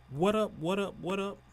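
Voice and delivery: loud, high hype intro; hype greeting. Real, not acted. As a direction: pump up the crowd. Podcast opener greeting hype.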